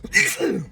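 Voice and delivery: sudden high-pitched sneeze; real sneeze. Real, not acted. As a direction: sneeze in the middle of talking. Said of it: "had to, like, sneeze".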